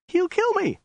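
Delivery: very high falsetto protest; terrified woman. A narrator's reading. Captive woman protesting to the soldier, terrified. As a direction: squeak it in high-voiced fear.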